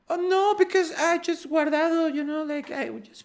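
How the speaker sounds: high-pitched imitation of a girl; playful mock girl excuse; pretty girl voice. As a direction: put on a high, girly voice. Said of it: "You have a very pretty girl voice".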